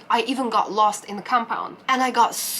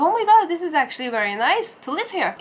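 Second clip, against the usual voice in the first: excited gush; 6 semitones higher, 4 dB louder, much faster than usual. Retold or imitated. Admiring his view.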